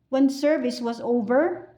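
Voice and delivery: usual speaking voice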